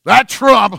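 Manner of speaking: high, loud show of exasperation; mock outrage. Voter passing judgment on a politician, mock-outraged. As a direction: throw out the name in hammed-up disgust. Mimics people judging politicians.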